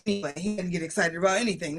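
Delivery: usual speaking voice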